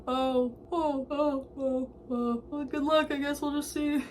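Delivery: lightly hammed-up high, soft voice; put-on high string of oh's; awkward instructor impression. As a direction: imitate someone taken aback, offering awkward sympathy. Voices instructor reacting to tearful confession.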